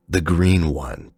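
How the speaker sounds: usual speaking voice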